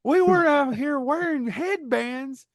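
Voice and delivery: Goofy doing Sam Kinison. Acted. Goofy cast as Sam Kinison, ranting about rice paddies. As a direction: scream it like a Kinison-style Goofy.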